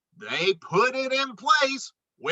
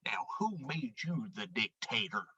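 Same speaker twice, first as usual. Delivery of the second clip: a low, much softer, fast grumble under the breath; mumbled; 9 semitones lower, 14 dB quieter, faster than usual. Acted. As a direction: grumble it so Moose won't hear. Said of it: "Porcupine mumbled".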